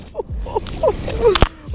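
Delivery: very high giddy squealing laugh. Real, not acted. Angler admires his catch, real high giddy laugh. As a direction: laugh with pure joy up high. Holding the big bass.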